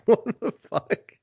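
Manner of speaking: slow, high, laughing what-the-fuck; laughing disbelief. Retold or imitated. Stunned by the random call, slow laughing high. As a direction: drag it out through a laugh. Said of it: "I was like".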